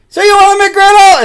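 very high, very loud service voice; loud drive-thru lady. Retold or imitated. Imitating the drive-thru lady taking orders, high and loud. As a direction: blare it like a cranked-up speaker. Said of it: "That's her voice".

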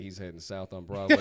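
loud, official-sounding radio report; dispatcher voice. Retold or imitated. Victim reporting thief's direction to dispatch, dispatcher-style call. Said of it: "He turned into a dispatcher himself".

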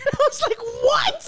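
high squeaky laughing what; baffled laugh. Retold or imitated. Retelling John's reply, a high laughing what. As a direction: squeal it out in disbelief.

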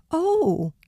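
high surprised "oh"; a pleasantly startled little exclamation; exclaiming. A narrator's reading. Girl touches hamster's fur for the first time, surprised. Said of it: "exclaimed Penny".

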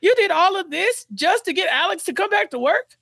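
high-pitched, hammed-up disbelief; mock incredulity. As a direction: react as if astonished, pitch way up. Acts out astonished reply to confession.

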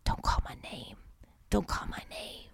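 breathy dramatic whisper. Acted. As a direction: hush it like a secret plea. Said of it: "do a little dramatic read".

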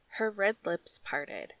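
usual speaking voice

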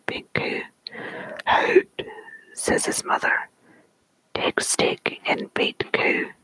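story poem whispered in a put-on Scottish accent. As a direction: whisper the tale with a playful Scots accent. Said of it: "read it with the proper accent".

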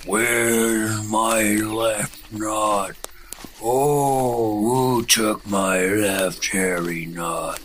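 slow, drawn-out ghostly moan; ghostly wailing voice. Acted. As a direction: moan it like a lost spirit. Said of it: "she heard this voice calling".

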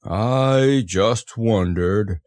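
slow, doleful Eeyore voice; drawn-out gloomy musing, not hushed; murmured to himself. A narrator's reading. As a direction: muse mournfully, dragging the words. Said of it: "he murmured to himself".

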